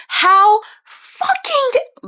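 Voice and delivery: high, slow, outraged cry. Retold or imitated. Reader furious at the book's ending, high outraged cry. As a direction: let the outrage break out high and drawn out. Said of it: "'cause I said".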